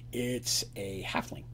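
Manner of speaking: slow, low and soft reveal; hushed, deliberate narration. A narrator's reading. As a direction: drop low and draw out the answer.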